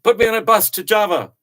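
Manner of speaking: high, loud, sustained call; acted loud demand. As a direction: call out the order at full volume. Acted demand to bus salesman.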